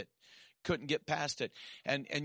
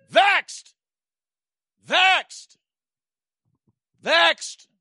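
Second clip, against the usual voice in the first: much higher, loud cried-out word "Vexed"; shouted refrain; 13 dB louder, much slower than usual. Acted. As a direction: cry "Vexed" out to the church.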